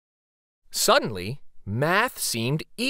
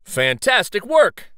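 higher and louder, delighted; excited praise; 9 semitones higher, 7 dB louder than usual. Acted. Teacher praising Jasper's poster, delighted and loud. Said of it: "cried Mister Hoppypot".

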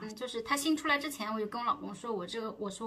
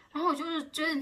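usual speaking voice

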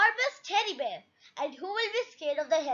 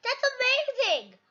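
high-pitched exclamation of wonder; amazed cry; 6 semitones higher than usual. Acted. Miss Rabbit sees the duck hooked, amazed cry. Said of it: "cries Miss Rabbit".

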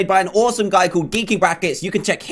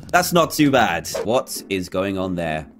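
ordinary delivery

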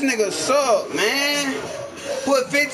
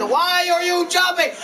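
angry yell; 6 semitones higher than usual. Real, not acted. Gamer yelling at teammate J R, angry yell. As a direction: blast him for a bad play. Raging at teammate mid-game.

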